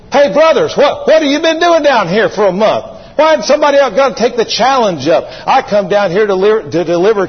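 put-on young David scolding his brothers; David indignant. David voiced challenging the brothers.

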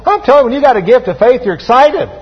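excited preacher driving the point home; excited, enthusiastic. Shown as a demonstration. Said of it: "say it with a little enthusiasm".

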